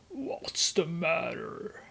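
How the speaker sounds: slow, soft, drawn-out whisper; just-woken whisper. Retold or imitated. Grace woken up by Joe, slow whisper. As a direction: imitate someone groggily whispering on waking. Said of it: "He wakes up, uh, Grace".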